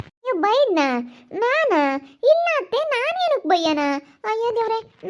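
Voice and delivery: high-pitched, incredulous denial; shocked protest. Acted. Daughter-in-law accused of scolding, shocked denial. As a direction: protest your innocence, pitch leaping up.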